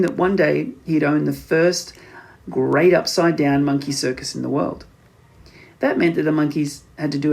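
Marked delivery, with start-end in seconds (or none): none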